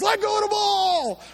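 high, slow crowd yell; yelled from the sidelines. Retold or imitated. Crowd on the sideline yelling at the boy, high and slow. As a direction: stretch the yell out like a whole crowd. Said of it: "They were yelling from the sidelines".

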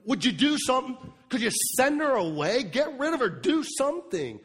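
hammed-up irritated nagging; annoyed disciples. Disciples pressing Jesus to send her away, exasperated. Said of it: "they're annoyed beyond me".

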